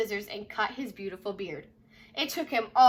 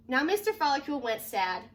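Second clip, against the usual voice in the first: usual speaking voice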